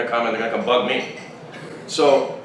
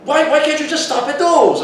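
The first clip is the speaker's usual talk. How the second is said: high-pitched mock whine; exasperated objector; 14 semitones higher, 4 dB louder than usual. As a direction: imitate someone objecting, voice pushed up. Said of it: "And he goes".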